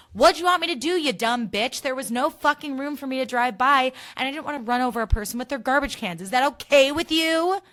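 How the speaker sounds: yelling at the rude driver, finally answering back; imagined yell. Retold or imitated. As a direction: shout down the driver. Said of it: "it made me so mad".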